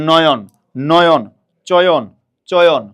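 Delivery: "নয়ন" and "চয়ন" called out loudly, each alone; firm, falling model of "নয়ন" and "চয়ন"; pronunciation drill. Shown as a demonstration. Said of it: "উচ্চারণ করেন".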